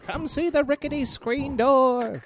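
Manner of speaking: high sideshow barker call; carnival barker. Acted. Barker showing off the rickety screen door. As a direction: hawk the door like an attraction.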